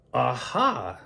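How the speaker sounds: usual speaking voice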